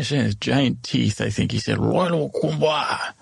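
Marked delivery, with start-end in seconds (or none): 1.8-3.1 slow, odd ogre voice; drawn out like a creature in a foreign tongue; slow ogre words. Retold or imitated. Ogre answering the traveller in his own language, slow and strange.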